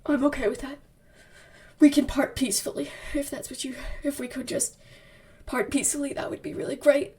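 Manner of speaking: soft, halting mock-noble martyr; quiet, overacted self-pity; hammed-up dramatic. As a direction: trail off softly between wounded pauses. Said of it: "he's all dramatic".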